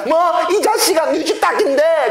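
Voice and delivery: put-on a girl shrieking at the boy over his dead hen; angry girl's scold. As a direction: scold him like a furious country girl.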